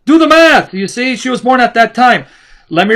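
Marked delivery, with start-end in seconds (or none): 0.0-0.7 loud, high mock yell; imitated shout. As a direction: punch it out like a mocking catchphrase. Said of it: "Like Sam O'Day says".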